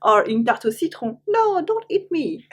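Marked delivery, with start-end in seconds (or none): 1.3-2.4 high squeaky little voice begging; pleading fruit. Pretend. Teacher voicing a pie apple pleading not to be eaten. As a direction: play a tiny apple begging for its life.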